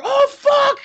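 high, loud hammed-up yelp of panic; cartoon scream of alarm; mock scream. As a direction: shriek as the flames hit. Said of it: "shoots a flamethrower".

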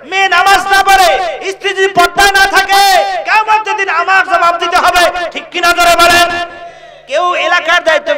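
high, ringing exhortation; sustained high-pitched preacher's call; forceful preaching. Real, not acted.

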